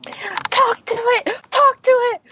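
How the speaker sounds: high, eager chant; excited shouting. Real, not acted. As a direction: urge them on loudly. Players egging on a dragon talk.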